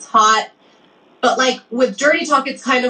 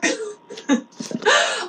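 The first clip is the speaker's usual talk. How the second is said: high-pitched laugh; short self-amused giggle; 11 semitones higher, 3 dB quieter than usual. Real, not acted. Laughs after admitting being a receiver.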